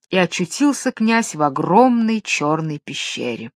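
plain reading voice

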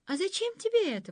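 high, quick, naive wonder; innocent surprise. A narrator's reading. Girl innocently surprised by the thorn-lady's boast. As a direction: ask it wide-eyed and guileless. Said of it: "простодушно удивилась Дунька".

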